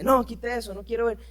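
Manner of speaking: lightly acted annoyed father; mock angry father. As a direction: brush the kid off like an irritated dad.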